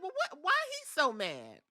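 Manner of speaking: high, hushed, puzzled aside; small high baffled voice; mock bewilderment. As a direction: squeak it out softly in confusion. Acts own baffled reaction to outburst.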